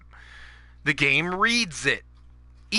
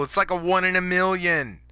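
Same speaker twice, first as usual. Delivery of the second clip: ordinary talk, nothing marked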